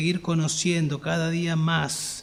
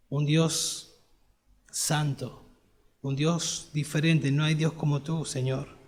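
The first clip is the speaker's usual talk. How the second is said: quiet prayer; 3 semitones lower, 4 dB quieter than usual. Shown as a demonstration. Preacher models a quiet prayer.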